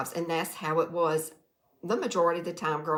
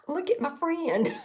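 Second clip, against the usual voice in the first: laughing; 11 semitones higher, 3 dB louder than usual. Real, not acted. Laughing at mounted animal heads.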